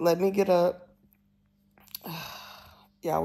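ordinary delivery

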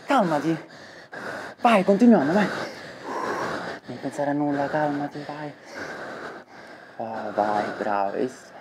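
soft reassuring. Acted. Calming a panic attack.

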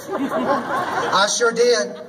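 drawn out slowly through a laugh; slow laughing admission. Real, not acted. Confessing to the crowd she deleted it, laughing. Admits taking down her post.

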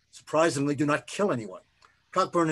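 usual speaking voice

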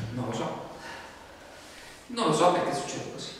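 usual speaking voice

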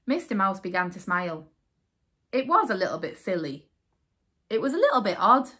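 plain reading voice

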